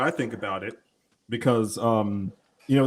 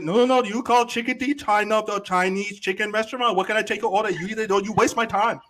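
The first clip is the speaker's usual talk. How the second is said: pitched-up mock Chinese accent, scolding the caller; 6 semitones higher, 4 dB louder than usual. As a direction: fake Chinese clerk, annoyed at the caller. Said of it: "that's so racist".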